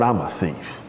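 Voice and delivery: very low tough-guy voice; Clint Eastwood. Acted. New inmate answers his cellmate, low tough act. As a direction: drop deep and soft, acting hard. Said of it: "put on my Clint Eastwood, John Wayne".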